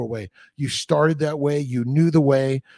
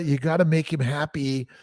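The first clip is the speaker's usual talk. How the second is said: ordinary talk, nothing marked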